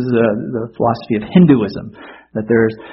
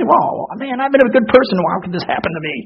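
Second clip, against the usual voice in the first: high, soft plaintive protest, put on; mock complaint; 8 semitones higher, 3 dB quieter than usual. Preacher imitating a disappointed believer questioning God, high mock complaint. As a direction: imitate a wronged believer pleading, pitched well up.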